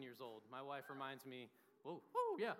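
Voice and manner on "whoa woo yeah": high, sudden whoa of surprise; a quick startled woo; surprised whoop. Real, not acted. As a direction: caught off guard by the room, whooping. Reacting to crowd mid-sentence.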